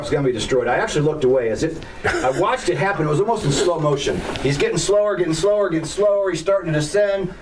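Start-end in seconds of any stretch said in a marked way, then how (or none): 4.4-7.3 tense build-up. Retold or imitated. Crash in slow motion.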